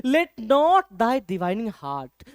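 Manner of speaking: very slow recital of the verse; slow poem reading. Shown as a demonstration. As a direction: read it out word by word. Teacher recites poem line.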